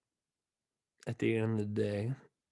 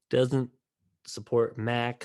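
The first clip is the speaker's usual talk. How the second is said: usual speaking voice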